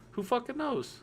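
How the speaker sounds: high-pitched, put-on shrug of a voice; mock throw-off. Engineer hedging on whether a fix might work, mock throw-off. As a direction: toss it off high, as if clueless.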